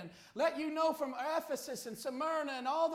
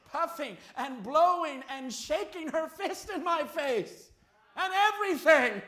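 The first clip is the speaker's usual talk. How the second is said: emphatic raging retelling; 4 semitones higher, 8 dB louder than usual. Retold or imitated. Preacher retells a woman's raging.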